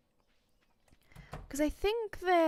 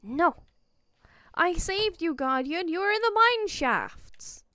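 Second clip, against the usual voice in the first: loud, higher mock protest at the creature; playful outraged yell; indignant shout; 4 semitones higher, 6 dB louder than usual. Real, not acted. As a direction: defend yourself playfully, loudly.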